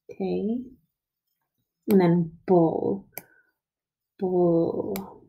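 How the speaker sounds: "Tay", then "bull", each slow and alone; stretched-out syllables. Shown as a demonstration. Teacher writing table syllable by syllable, stretched out. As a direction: say "Tay" and "bull" as separate drawn-out beats. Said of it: "we stretched out with our syllables".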